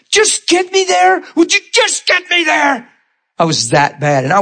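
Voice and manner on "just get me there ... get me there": much higher, slow cry; high strained shout; pained yell. Retold or imitated. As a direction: shout the plea through the pain. Pain-stricken yelling at driver.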